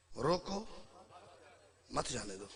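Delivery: रोको rising, long pause, low falling मत जाने दो; soft order: रोको, break, मत जाने दो; pause after रोको. Shown as a demonstration. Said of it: "लहजा बदलने से मतलब बिल्कुल". ("रोको" के बाद ठहराव, "मत जाने दो" धीमे)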